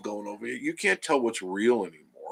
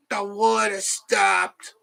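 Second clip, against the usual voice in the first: put-on slow, high New York accent for his wife; 11 semitones higher, 6 dB louder, much slower than usual. Husband voicing his wife's New York accent, slow and high. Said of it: "She doesn't have a New York accent, but I just added that".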